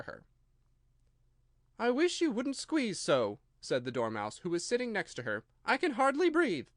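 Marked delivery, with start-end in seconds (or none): 1.8-3.4 slow, much higher squeaky complaint; a small creature griping; higher slow whiny voice; complaining Dormouse. A narrator's reading. 5.6-6.7 a small creature griping; complaining Dormouse. A narrator's reading.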